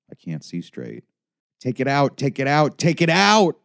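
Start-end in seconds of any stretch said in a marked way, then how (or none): 1.6-3.6 building high, loud scream. A narrator's reading. Child begging doctors, building to a scream. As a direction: scream it louder each time, pleading. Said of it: "I'm screaming".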